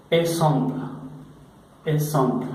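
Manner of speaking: "Exemple" said the French way, eh-ZAHMPL, with a nasal middle and no final o; French pronunciation. Shown as a demonstration. Teacher modelling a French word for students, French "Exemple". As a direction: clearly model "Exemple" in French, dropping the Spanish ending. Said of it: "De esta forma se escribe la palabra ejemplo en francés".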